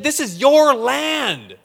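slow, loud, high, stress on your; God's slow, loud promise with "your" pressed; slow emphatic promise. Acted. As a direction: lean hard on "your" and draw out "This is your land". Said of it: "He said".

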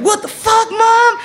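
mock outrage. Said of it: "be like".

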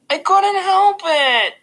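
put-on very high, slow little-kid whine; drawn-out childish whine; whining. A narrator's reading. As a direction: whine loudly, dragging out the words. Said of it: "kinda whining".

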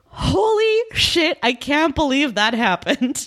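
very high, drawn-out shock; mock stunned disbelief. Host reads out her note on the surprise kiss, stunned disbelief. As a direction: squeal it out in exaggerated astonishment.

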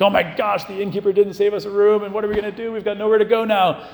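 husband Joseph panicking about the missing room, mock frantic; mock freaking out. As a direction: play the flustered husband, a bit hammed-up. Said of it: "freaking out and being like".

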